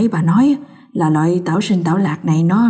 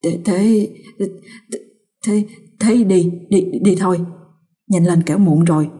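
put-on stuttering, shaken old man; old husband stammering in fright to his wife; trembling stammer. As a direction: stumble over the words in fear. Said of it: "Lão run rẩy dục".

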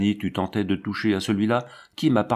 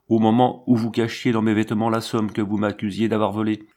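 ordinary delivery

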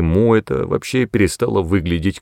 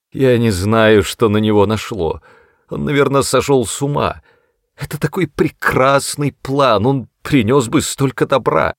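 stammering; 5 semitones higher, 4 dB louder than usual. A narrator's reading. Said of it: "заикаясь".

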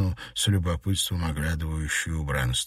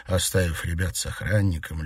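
ordinary delivery

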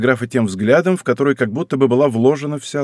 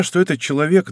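plain reading voice

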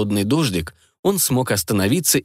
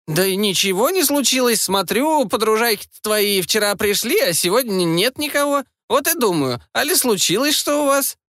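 put-on nosy old granny; 11 semitones higher than usual. Nosy neighbour granny questions.

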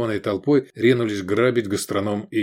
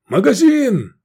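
much higher, loud croak; croaked shout; 13 semitones higher, 7 dB louder, slower than usual. A narrator's reading. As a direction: caw it out from the ground. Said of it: "каркнул".

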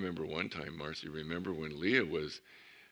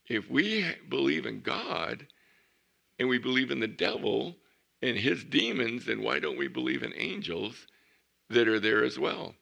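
emphatic preaching; 5 semitones higher, 6 dB louder than usual. Real, not acted. Preacher challenging the congregation, emphatic and louder. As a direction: drive the point home from the pulpit.

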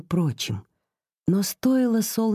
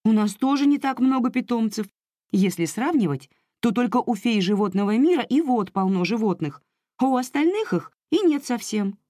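upset fairy; 5 semitones higher than usual. Acted. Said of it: "Расстроились феи зимы".

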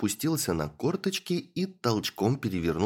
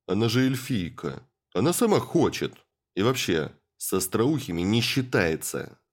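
brutish lout; 2 semitones higher, 4 dB louder than usual. Acted. Brutish student justifying himself.

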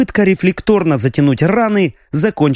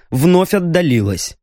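ordinary delivery, nothing marked

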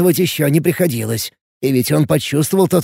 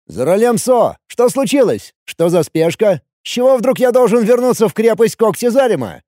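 higher, puzzled questioning; недоумённый вопрос; 7 semitones higher than usual. A narrator's reading. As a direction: ask what the rush is, pitched up. Сотник отвечает на срочный вызов. (недоумённо, повышенным тоном)

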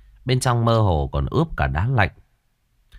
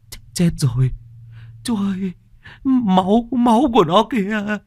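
stammering terror; 12 semitones higher than usual. A narrator's reading. Trembling crewman pointing at the blood, terrified stammer. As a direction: stutter in horror. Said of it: "run cầm cập, miệng lắp bắp".

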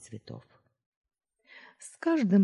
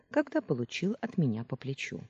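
plain reading voice

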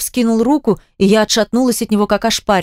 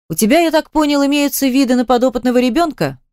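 higher-pitched probing question; needling question; 6 semitones higher than usual. A narrator's reading. Dan probing Vika about adopting the child, needling. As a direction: press with a pointed, raised-pitch question.

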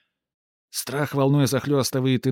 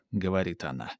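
ordinary delivery

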